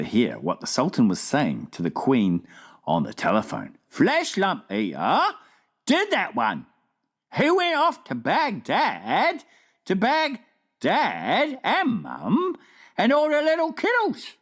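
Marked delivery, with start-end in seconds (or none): 3.9-14.4 slow, higher, folksy giant voice; a kindly giant recalling, drawn out; friendly giant. A narrator's reading. The BFG tells the Queen what he saw, slow and folksy.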